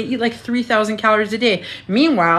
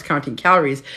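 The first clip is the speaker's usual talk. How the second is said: ordinary talk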